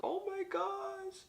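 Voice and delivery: mock a lash tech hyping up her client; sing-song fake delight over bad lashes; overhyping gush. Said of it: "she was overhyping the hell out of me".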